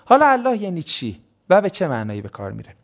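normal talking voice

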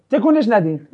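ordinary talk

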